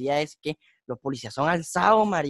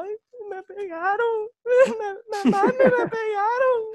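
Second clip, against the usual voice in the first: much higher, slow whiny fake sob; mock crying; slower than usual. Retelling himself crying to his mother, hammed-up sobbing. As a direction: wail it like a crying kid telling mom. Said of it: "Y yo llorando como una hueva".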